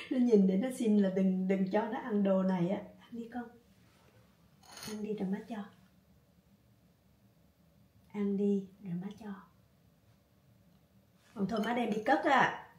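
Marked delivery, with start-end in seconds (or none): 3.0-9.3 soft coaxing. Real, not acted. Coaxing dog softly.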